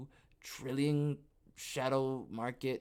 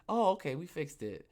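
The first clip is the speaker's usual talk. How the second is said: normal talking voice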